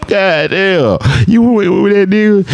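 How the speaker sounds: roast him in disbelief; high teasing exclamation; incredulous mocking. Real, not acted. Mocking ex-boyfriend.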